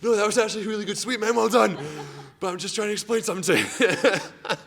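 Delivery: high, strained mock-reassurance from the floor; mock winded. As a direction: squeeze out praise as if knocked flat. Retelling lying winded after being swept.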